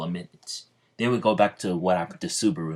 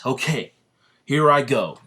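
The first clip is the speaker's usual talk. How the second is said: eager battle cry; 6 semitones higher, 7 dB louder than usual. Retold or imitated. Yami before attacking.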